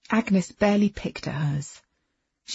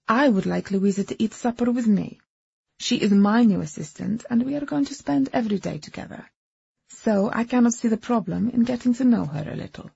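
put-on Eastern European accent; 4 semitones higher, 3 dB louder than usual. A narrator's reading. Agnes voiced with accent.

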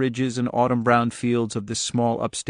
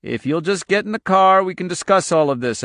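higher, insistent persuasion; coaxing; 7 semitones higher, 5 dB louder than usual. Acted. Agent urging them into the car, coaxing. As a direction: talk them round, voice lifted.

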